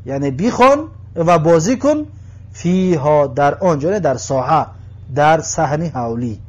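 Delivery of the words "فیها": ordinary delivery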